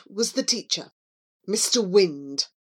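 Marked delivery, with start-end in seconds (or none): none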